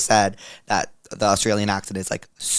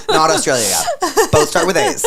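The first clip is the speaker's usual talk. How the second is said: laughing; 5 dB louder than usual. Real, not acted. Joking, laughing.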